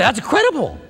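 eager and high, pitching up on each question; excited questions. Retold or imitated. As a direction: gush with curiosity about the angel. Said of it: "I would say".

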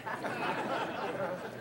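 quiet unvoiced chuckle; soft breathy laugh. Real, not acted. Preacher chuckling at his own joke, soft and breathless. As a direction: laugh under your breath.

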